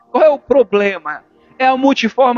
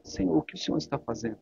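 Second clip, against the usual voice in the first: quick, soft, near-whispered plea to God; softly pleading prayer; hushed tearful prayer; 5 semitones lower, 14 dB quieter, faster than usual. Retold or imitated. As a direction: murmur it fast, as if close to tears. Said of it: "Eu voltei do trabalho chorando".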